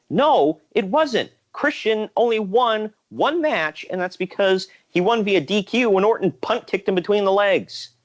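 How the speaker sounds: high, animated pushback; heated rebuttal. Retold or imitated. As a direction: argue it high and fired up. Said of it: "I'm like".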